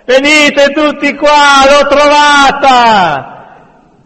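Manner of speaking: very high, loud, drawn-out holler; shouting. A narrator's reading. Man summons the others to his find, shouting. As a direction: call the whole mob over. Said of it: "Si sentì vociare".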